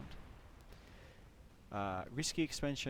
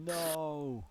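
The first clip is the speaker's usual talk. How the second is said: higher, drawn-out cry of disbelief; dismayed disbelief; 6 semitones higher, 4 dB louder than usual. Real, not acted. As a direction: react aghast to the loss. Reacting to news of lost tank.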